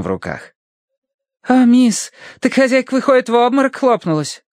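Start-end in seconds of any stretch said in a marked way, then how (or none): 1.5-4.4 put-on high Irish maid accent; chatty Irish maid. A narrator's reading. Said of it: "с безошибочно угадывающимся ирландским акцентом".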